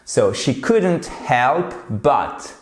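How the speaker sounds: slow, deliberate English; slow model phrase. Shown as a demonstration. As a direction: spell the phrase out slowly for learners. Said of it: "Elle ne pouvait pas s'empêcher de". (phrase anglaise lente et articulée)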